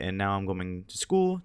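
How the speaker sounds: ordinary talk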